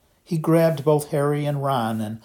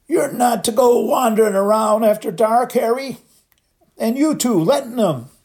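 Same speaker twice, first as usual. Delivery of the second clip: a giant's sudden furious roar, much higher and louder; 11 semitones higher, 7 dB louder than usual. A narrator's reading. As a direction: bellow it so they jump. Hagrid keeps roaring.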